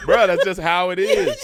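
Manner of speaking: loud laughing dad voice; dad impression. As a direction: play dad shrugging it off, loud. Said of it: "That's how he sounds".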